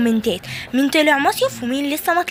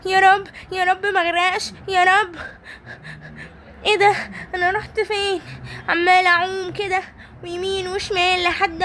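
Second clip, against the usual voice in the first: put-on high, panicky childlike pleading; lost at sea and frightened; scared toy voice; 7 semitones higher than usual. Simba swept away, scared. (خوف طفولي بصوت عالٍ)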